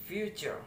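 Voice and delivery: FU of "future" pitched high, then falling; first-syllable stress. Shown as a demonstration. Student repeating "future" to show first-syllable stress. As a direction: lift the first syllable of "future", let the second drop. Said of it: "the syllable stress that is future".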